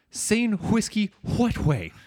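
high, drawn-out "hwiskey, hwat hway"; imitating feigned innocence with exaggerated "hw" sounds; weird wh pronunciation. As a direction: puff an h into whiskey, what and way, slowly and high. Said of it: "Why do you say it like that".

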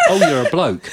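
bursting into laughter at the reveal; a mock kid cracking up; laughing mock surprise. Kids realising he's a man.

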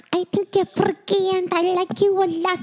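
high-pitched imitation of the singer; squeaky sung-speech impression. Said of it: "That's a good impression".

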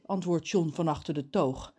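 usual speaking voice

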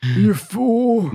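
high, theatrical scolding; hammed-up villain insult; mock insult.

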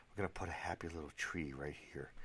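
soft, hushed painter's murmur; gentle hushed Bob Ross. Acted. Podcaster softly imitating Bob Ross, a light hush. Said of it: "call this the Bob Ross podcast".

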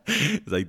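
high burst of laughter. Real, not acted. Amused by own tourist impression, laughing. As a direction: laugh at your own joke.

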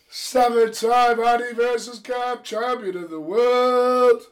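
high, slow champion announcement; boxing announcer. Acted.

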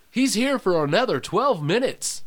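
high, wavering announcer call; hammed-up high announcer voice; mock announcer. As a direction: sing it out like a hype man. Mock announcer after drum fill.

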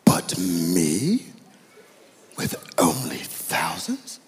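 slow, incredulous British voice, plunging on "but me"; hammed-up British outrage, questioning lilt; British accent. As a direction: play the affronted British king. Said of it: "he has a British accent".

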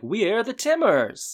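high, drawn-out arrival greeting; imitating guests making a grand entrance; hammed-up announcement. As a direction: announce yourselves in a high, stretched voice. Couple announce themselves on arrival.

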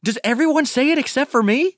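put-on high, indignant little kid; whiny kid voice. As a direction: complain like a left-out child. Kid character whining, high voice.

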